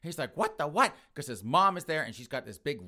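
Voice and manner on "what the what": high, loud mock disbelief; shocked outburst. Son finding his mom hurt, shocked. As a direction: burst out baffled, pitched up.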